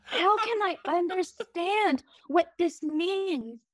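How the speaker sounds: high, slow mock-tearful pleading; melodramatic drama recital. Lead actress reciting cheesy line over dying boyfriend, mock-tearful. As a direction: ham up a soap-opera death scene. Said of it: "crying while reciting this line".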